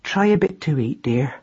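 soft, tender coaxing; gentle wifely care. A narrator's reading. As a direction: coax your hurt husband softly to eat. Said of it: "said Madeline gently".

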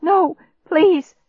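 high, loud plea; desperate pleading. A narrator's reading. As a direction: beg urgently to be believed. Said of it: "I pleaded".

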